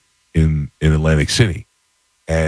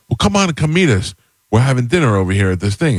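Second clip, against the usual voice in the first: higher-pitched, lively retold invite; eager invitation; 5 semitones higher than usual. Actor friend invites storyteller to join dinner, eager invitation. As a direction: imitate a friend urging him to come along. Acting out Vinny's inviting phone call.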